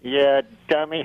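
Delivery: low, lightly voiced dummy retort; mock the host as the puppet; ventriloquist's puppet. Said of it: "let me get Rusty out here".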